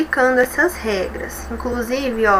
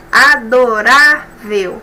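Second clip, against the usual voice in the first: Adorável slow, with RÁ drawn out and louder; stress on RÁ; 6 dB louder, much slower than usual. Shown as a demonstration. Teacher to class, spelling out stress in Adorável. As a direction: lean hard on RÁ in Adorável, slowly. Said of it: "a penúltima sílaba é a mais forte".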